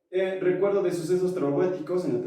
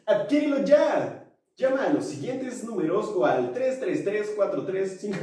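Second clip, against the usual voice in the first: over eager infomercial; 6 semitones higher than usual. Pretend. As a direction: push buyers to call now. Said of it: "Me sentí como infomercial".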